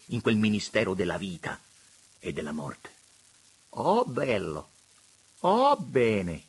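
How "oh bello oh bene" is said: soft limp cooing. A narrator's reading. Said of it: "molle, molle".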